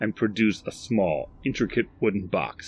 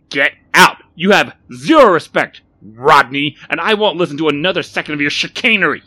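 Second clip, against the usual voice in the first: loud, raised angry tirade; furious shouting; 9 semitones higher, 14 dB louder than usual. A narrator's reading. Barry rails at Rodney to leave, furious. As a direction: shout them out of the room in outrage. Shopkeeper angrily ejects the man.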